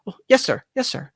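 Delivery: bow and report to the master politely; eager-to-please housemaid; timid maid Bonnie. Acted.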